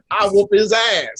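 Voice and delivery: loud, laughing mock threat; playful loud tough talk; loud joking boast. Real, not acted. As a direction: brag it loudly in fun. Joking threat among friends.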